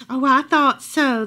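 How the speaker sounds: slow, smiling, knowing reply; with a smile. A narrator's reading. Old woman answers Camilla kindly, smiling. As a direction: smile through it, pleased. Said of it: "said with a smile".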